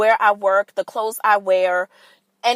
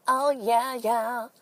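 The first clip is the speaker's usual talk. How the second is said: [name] impression; 2 semitones higher, 4 dB quieter, much slower than usual. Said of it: "did you like my [name]".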